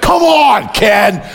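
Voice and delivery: high, loud playful shout; mock exasperation. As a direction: holler at him in comic disbelief. Mock exasperation at wrong prediction.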